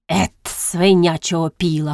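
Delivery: ordinary delivery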